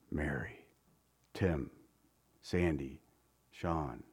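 low, hushed name-calling; gentle calling. Acted. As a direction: call each name softly and low, with pauses. Jesus gently calls each by name.

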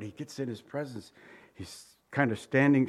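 ordinary delivery

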